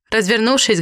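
ordinary delivery

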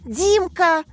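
overjoyed. A narrator's reading. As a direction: greet a favourite pupil with delight. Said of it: "обрадовалась Катя".

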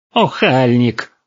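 high, drawn-out reproach; stretched, scandalised single word; indignant scolding. A narrator's reading. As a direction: scold him like an outraged granny. Granny scolds him with an elbow.

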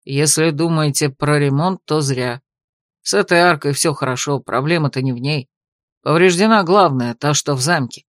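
low-voiced senior guard. A narrator's reading. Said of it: "старший стражник даже сказал".

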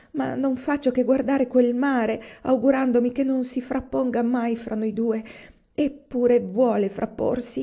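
begging him not to go; anxious pleading. Acted. Wife pleading husband not to leave. (moglie angosciata supplica il marito di restare)

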